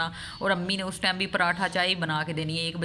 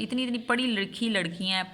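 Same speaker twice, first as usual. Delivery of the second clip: ordinary talk, nothing marked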